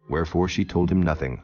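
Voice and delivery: normal talking voice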